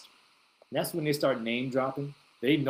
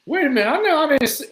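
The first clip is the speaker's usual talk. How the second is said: much higher and louder, frantic double take; high yelping disbelief; Cat Williams impression; 13 semitones higher, 9 dB louder, faster than usual. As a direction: squeal it like Cat Williams in shock. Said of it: "I'm like Cat Williams".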